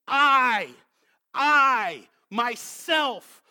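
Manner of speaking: "I, I, myself" each punched loud and set apart; emphatic. Acted. Preacher reading God's promise to seek his sheep, emphatic "I, I, myself". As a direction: hammer each word of "I, I, myself" with a pause between. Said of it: "could he say it with more emphasis".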